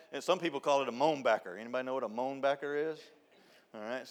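ordinary delivery

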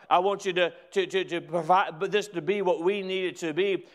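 loud, high, ringing sermon climax; preaching at full force. Acted. Pastor rouses his church, preaching loud and high. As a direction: lift the room, preach it out.

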